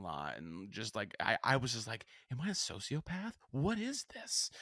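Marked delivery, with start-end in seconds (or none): 2.3-4.5 high-pitched, disbelieving self-questioning; mock bewilderment. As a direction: question yourself in exaggerated alarm, pitch climbing. Retold alarmed question to himself.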